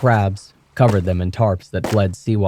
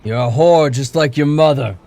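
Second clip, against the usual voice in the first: put-on furious drunk father, louder and higher; thundering; 6 semitones higher, 5 dB louder than usual. A narrator's reading. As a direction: rage at her drunkenly. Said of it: "he thundered".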